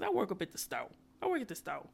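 ordinary delivery

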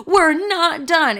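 high, slow, drawn-out mock of the ex; menacing ex. Ex swerving the car, mocked high and drawn out. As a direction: drag each word out high like an unhinged boyfriend. Ex repeating threat.

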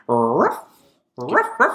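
imitated dog barks climbing high. Host announcing his underdog pick, imitated dog barking. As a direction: bark like a dog, voice jumping up. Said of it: "Yeah, I barked so long".

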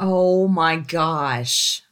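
very slow, stretched-out oh my gosh; a slow, long gasp of oh my gosh; drawn out. Real, not acted. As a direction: drag out each word in disbelief. Stunned reaction to the story.

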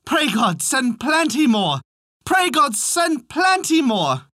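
high, slow chanted call; drawn-out singsong refrain; repeated cry. A narrator's reading. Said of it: "charge him to repeat".

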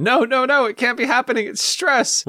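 high-pitched panicked protest; Christopher Robin. Acted. Quoting Christopher Robin from the film, high and fretful. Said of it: "Or from, uh, Christopher Robin".

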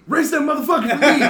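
loud, fast, high imitation of an egging-on passenger; retold yell. Passenger urging friend to race another car, yelling. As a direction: yell it across to the next car, mock-urgent. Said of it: "he yells at me".

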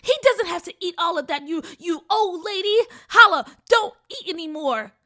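fast, high, loud frantic yell. Acted. Sister yelling at the old lady, fast frantic yell. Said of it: "Gigi yelled".

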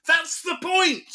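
high, slow, very loud outburst; exasperated shout. Acted. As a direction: yell it in hammed-up exasperation, drawing it out. Exasperated retort to imagined caller.

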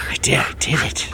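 hushed, breathy little admission; whispered confession. Acted. Over the body, whispering a smug confession. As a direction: whisper it like a guilty secret.

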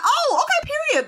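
high, loud, satisfied squeal; delighted kid. Retold or imitated. Little girl thrilled by the dictionary answer, high squeal. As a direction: seal it like a thrilled child. Child accepting teacher's answer.

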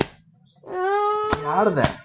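calling out. Real, not acted. Calling to child in another room.

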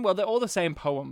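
normal talking voice

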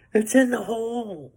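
high, mocking fan cry; golf heckler. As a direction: crow it like a drunk fan at the tee. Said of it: "I hear someone go".

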